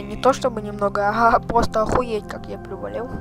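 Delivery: usual speaking voice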